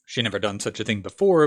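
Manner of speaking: ordinary delivery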